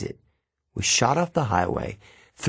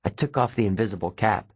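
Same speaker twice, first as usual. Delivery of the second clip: plain reading voice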